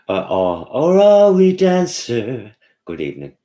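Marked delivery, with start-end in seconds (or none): 0.7-2.5 high, loud, slow sing-song voice; put-on voice. Vlogger reading a viewer comment in a silly high voice.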